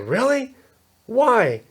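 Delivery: high, surprised bystanders asking why; a touch of disbelief; mock surprise. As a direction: play it like shocked onlookers. Bystanders surprised in retold story.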